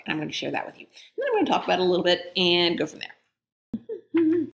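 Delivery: plain reading voice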